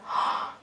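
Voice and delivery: acted gasp of surprise; shocked gasp. Mama's shocked reaction, retold.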